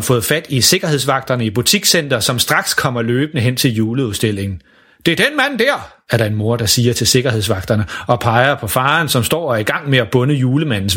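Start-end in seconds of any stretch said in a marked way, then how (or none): 5.1-5.9 mother's voice. Acted. Mother points out father.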